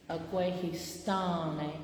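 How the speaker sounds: "Agwehistane" drawn out slowly, every syllable spelled out, tone change on "sta" made plain; exaggerated pronunciation. Shown as a demonstration. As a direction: stretch "Agwehistane" slowly like a teacher. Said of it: "I'm doing this really, really exaggerated".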